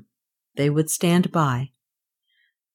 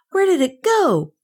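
put-on high-pitched child's question; urgent cry; 10 semitones higher than usual. A narrator's reading. Kay asks the Wind Boy where it went, crying out. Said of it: "Kay cried".

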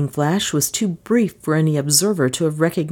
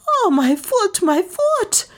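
very high-pitched hysterical wail; hysterical squeal; slower than usual. A narrator's reading. Maid with boiling coffee on her shoe, hysterical squeal. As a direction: squeal in pain and panic. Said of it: "she squealed hysterically".

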